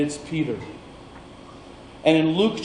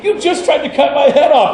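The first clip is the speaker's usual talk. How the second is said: much higher, louder mock outrage through laughter; laughing complaint; 9 semitones higher, 4 dB louder than usual. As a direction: play the servant half-laughing. Malchus complaining to Peter, laughing.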